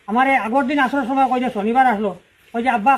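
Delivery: ordinary talk, nothing marked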